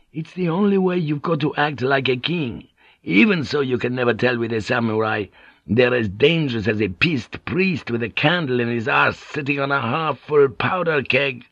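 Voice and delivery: high, lilting foreign-sailor voice; animated Portuguese pilot. Acted. Pilot explaining the dangers of samurai to Blackthorne, animated. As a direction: explain with colourful, emphatic bravado.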